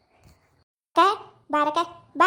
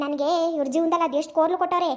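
ordinary talk

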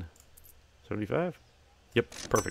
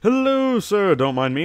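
high bright sing-song shop greeting, louder; cheery shopkeeper; 11 semitones higher, 9 dB louder than usual. Acted. Shopkeeper hailing a customer entering the store, cheery and loud. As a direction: welcome customers like an eager shopkeeper.

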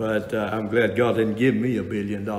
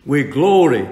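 preaching at full force; 4 semitones higher, 5 dB louder than usual. Acted. Preaching on patience.

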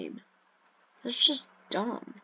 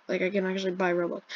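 ordinary delivery

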